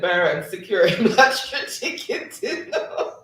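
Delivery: real laughter breaking up the words; laughing. Real, not acted. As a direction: read it, rising into a giggling finish. Laughs reading lottery joke.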